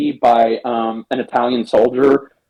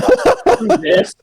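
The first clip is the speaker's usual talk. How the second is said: high, laughing throwaway line; laughing Indy. Retold or imitated. Indy gives up on the fake priest act, laughing high. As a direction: toss it off through a laugh, way up high. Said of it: "Indy's like".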